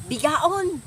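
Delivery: high, loud yelled insult; retold yell. Boss yelling at the young sweeper, retold loud yell. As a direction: fling the insult at her like the boss did. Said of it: "Pinagsigawan niya ako".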